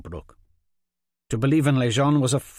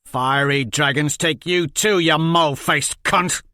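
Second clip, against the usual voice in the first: loud, higher snarling curse; angry insult; 5 semitones higher, 7 dB louder than usual. Acted. Jenko curses Polly back, loud and angry. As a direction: hurl the insult.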